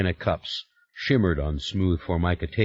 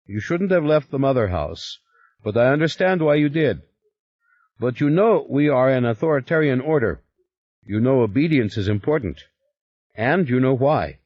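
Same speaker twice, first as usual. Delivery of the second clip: louder, firm superior; stern Stoloff; 6 semitones higher, 3 dB louder than usual. Acted. Stoloff lecturing Yuri on the order's obedience, firm. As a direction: remind him who's in charge.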